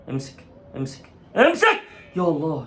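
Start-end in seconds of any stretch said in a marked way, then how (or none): 0.1-1.1 breathless whispered urging; tense whisper. Real, not acted. Tense moment, goalkeeper. 1.4-1.8 urgent cry. Real, not acted. Tense moment, goalkeeper.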